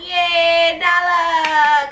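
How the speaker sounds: high sung yay for Nala; cheering. Real, not acted. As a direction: sing out a happy cheer.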